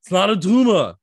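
put-on loud, high Austrian-accented outburst; Arnold impression. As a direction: bellow it as a mock Austrian action star. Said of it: "bummed that Arnold never pronounced".

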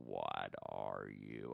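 very low, slow alien mimic; giant alien booming voice. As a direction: drag it out deep like a monster. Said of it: "adapt other people's voices".

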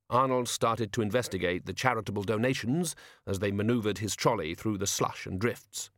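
plain reading voice

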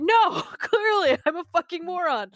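high, slow, laughing self-put-down; self-mocking laugh. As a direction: laugh at your own stupidity, up high. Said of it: "And I'm like".

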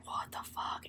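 barely voiced, shocked whisper; stunned whisper. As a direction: whisper it in mock disbelief. Frightened girls react to moved chair.

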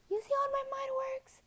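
very high, breathless little whisper; a tiny squeaked secret; squeaky whisper. Pretend. Telling viewers how her mind works, in a very high whisper.

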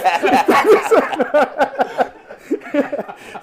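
long high laugh falling away; burst of laughter. Real, not acted. Teller cracking up after the punchline, long falling laugh. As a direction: crack up at your own story.

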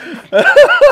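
high squealing burst of laughter; real shrieking laugh. Real, not acted. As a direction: let out a shrill cackle. Big laugh at own story.

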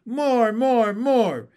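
high, loud, insistent clamor; loud, high-pitched begging cries; screaming tadpoles. A narrator's reading. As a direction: demand it like greedy little tadpoles. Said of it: "screamed the tadpoles".

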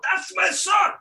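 a cheering father's shout from the sideline; mock cheer from the touchline; proud shout. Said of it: "I shout".

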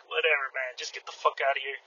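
a muttered curse meant for nobody; under his breath. Retold or imitated. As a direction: say it so only you hear it. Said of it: "I didn't say that".

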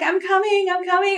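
high hurried reassurance; squeaky I'm coming call; flustered, high. Retold or imitated. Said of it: "She's like".

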